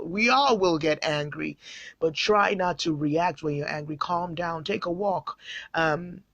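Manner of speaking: normal talking voice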